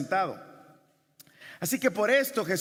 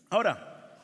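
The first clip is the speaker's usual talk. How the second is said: ordinary delivery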